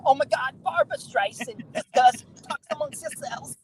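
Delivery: high-pitched coffee talk hostess parody; Mike Myers. Retold or imitated. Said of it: "Mike Myers".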